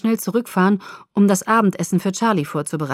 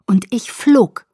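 slow, louder, soaring; elated; 3 semitones higher, 5 dB louder, slower than usual. A narrator's reading. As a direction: let the words take flight slowly. Exhilarated flight moment.

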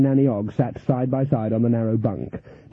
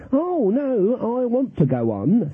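high, eager mock-worried old lady; Granny eager to keep playing; anxious grinning granny; 10 semitones higher, slower than usual. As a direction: plead with a nervous grin. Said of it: "grinning anxiously".

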